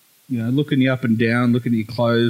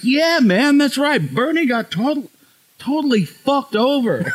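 put-on high, emphatic American accent; American Uber driver; 12 semitones higher, slower than usual. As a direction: imitate an American driver heartily agreeing, voice way up high. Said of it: "Uber with this guy".

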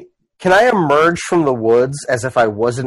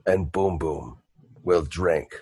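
Boom Boom; 7 semitones lower, slower than usual. Acted. Half-orc admits to the dwarves he has no coin, ponderous. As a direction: drop low and plod, with long pauses. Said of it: "Boom Boom goes, he goes".

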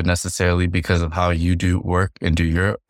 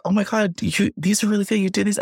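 raised-pitch imitation of her gushing praise; excited friend quote; 14 semitones higher than usual. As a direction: mimic her amazed compliments, pitched up.

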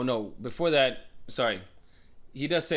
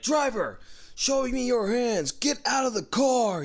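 high, drawn-out cop commands; retold police yell; 11 semitones higher than usual. Cops at a roadblock ordering the driver out, commanding yell. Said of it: "they're fucking yelling".

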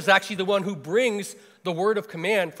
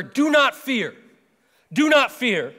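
emphatic command; 5 semitones higher, 3 dB louder, slower than usual. Acted. Elijah comforts the widow.

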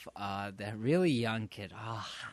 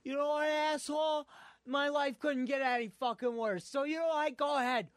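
defiant outburst; 12 semitones higher than usual. Retold or imitated. Cashier daring the robber to shoot, mock defiant yell. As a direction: dare him to shoot, fed up with life. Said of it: "And he goes".

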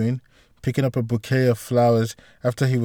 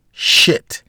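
startled curse; 4 dB louder than usual. Acted. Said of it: "he exclaimed".

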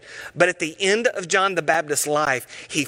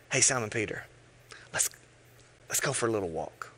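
soft whispered invitation; 5 semitones lower, 8 dB quieter, faster than usual. Retold or imitated. Jesus quietly inviting Simon Peter for a walk, whispered. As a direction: hush it, intimate and private.

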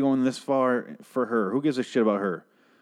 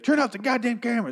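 much higher-pitched mock yell; retold yell; 12 semitones higher than usual. Upset cast member at the film crew, mock high-pitched yell. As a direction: yell it in a high, imitated outburst. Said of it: "yells at the crew".

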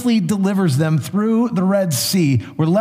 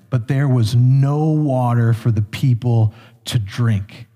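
lower, measured Bible reading; scripture reading; 5 semitones lower than usual. Acted. Pastor reading Exodus to the congregation, lower and measured. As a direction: read the passage with weight.

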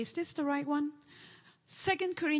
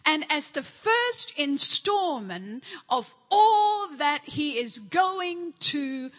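preaching scripture; 3 semitones higher, 4 dB louder than usual. Real, not acted. Reading scripture with emphasis.

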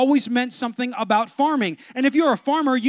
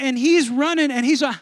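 ordinary delivery

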